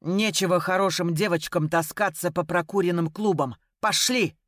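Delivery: ordinary delivery, nothing marked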